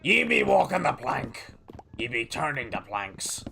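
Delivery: lightly voiced pirate talk; pirate captain. Pirate streamer narrating his boat build, lightly voiced pirate. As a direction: play a grumbling-along pirate builder.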